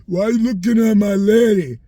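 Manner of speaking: loud aggressive street tough demanding answers; tough guy challenge. Retold or imitated. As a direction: bark it like a jealous boyfriend picking a fight. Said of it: "look at and say".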